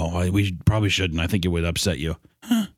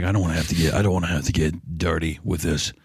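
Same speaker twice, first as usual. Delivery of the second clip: a touch of sinister Arthur; a hint of low menace; 4 semitones lower than usual. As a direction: slightly lower threatening kid. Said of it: "And he's like".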